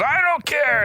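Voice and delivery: hammed-up yell of I don't care; escalating mock shout. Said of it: "they keep escalating it".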